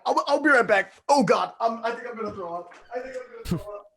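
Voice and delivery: high, queasy gagging panic; nauseated horror. Acted. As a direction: squeal like you're about to vomit. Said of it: "I'm be like".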